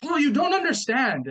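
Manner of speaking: high, loud dismissive whine; playing the wife who waves him off; mock exasperated wife. Husband mimics his wife's loud, high dismissal.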